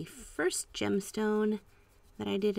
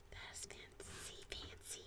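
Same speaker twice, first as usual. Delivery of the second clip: hushed, drawn-out whisper of delight; awed whisper; 21 dB quieter, slower than usual. Real, not acted. Admiring a new paint swatch to viewers, awed whisper. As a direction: whisper it slowly, very softly.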